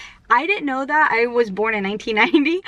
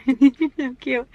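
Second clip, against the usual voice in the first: laughing; 4 semitones higher than usual. Real, not acted. Laughs at tiny fries.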